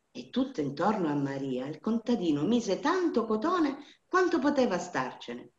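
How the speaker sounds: ordinary delivery, nothing marked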